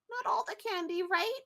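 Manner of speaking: put-on high, pleading child voice; small worried kid seeking reassurance; teary-eyed kid. Kid fearing all the candy is gone, mildly tearful. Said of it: "get a little teary-eyed".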